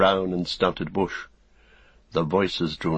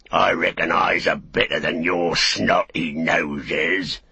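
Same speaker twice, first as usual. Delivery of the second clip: big orc; 3 dB louder, slower than usual. Acted. Big orc keeps mocking.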